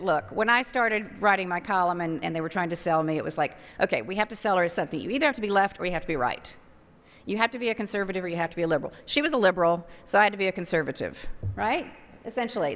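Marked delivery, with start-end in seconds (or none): 3.8-8.9 rattled off fast as a brisk marketer; fast, matter-of-fact pitch like a salesman; rushed sales pitch. Retold or imitated. Columnist imitating marketers, rattling off their pitch fast.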